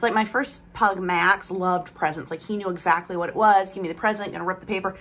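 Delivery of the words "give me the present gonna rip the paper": ordinary talk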